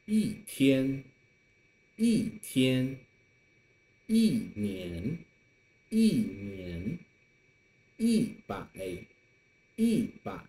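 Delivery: 一天 一年 一百 with 一 falling; each 一 read as a falling yì; fourth tone yì. Shown as a demonstration. Teacher reads examples for students, 一 falling. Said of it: "I'll read it as the fourth tone".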